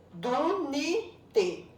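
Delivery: "Dunite" in three separate beats, Du - ni - te; split into syllables. Shown as a demonstration. Teacher showing separated syllables, "Dunite" chopped into Du - ni - te. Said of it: "já tá separada a sílaba".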